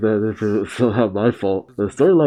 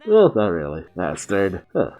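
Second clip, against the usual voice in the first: ordinary talk, nothing marked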